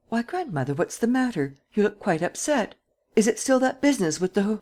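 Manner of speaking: put-on a lively girl fussing over her grandmother; bright young Geneviève. A narrator's reading. Geneviève enters, cheerful girl.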